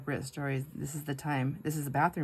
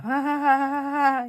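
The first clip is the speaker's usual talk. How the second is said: high, loud forced nervous laugh; strained, put-on laughter; 11 semitones higher, 9 dB louder than usual. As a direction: imitate a nervous ha-ha-ha. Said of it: "My nervous laugh".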